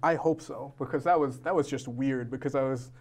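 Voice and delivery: usual speaking voice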